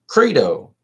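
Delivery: lightly put-on character voice, drawn out; trial Crito voice. Shown as a demonstration. Reader auditioning a voice for Crito, lightly put on. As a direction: try out a voice for Crito slowly. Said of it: "come up with two voices".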